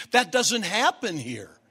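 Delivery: ordinary talk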